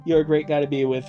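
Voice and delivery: ordinary talk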